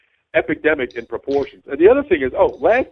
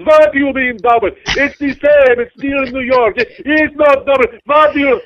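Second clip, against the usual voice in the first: high, loud, slow disbelief; outraged passenger; 9 semitones higher, 6 dB louder, slower than usual. Retold or imitated. Passenger refusing the doubled fare, high and loud. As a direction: protest the double fare, scandalized. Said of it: "She goes".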